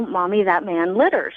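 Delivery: usual speaking voice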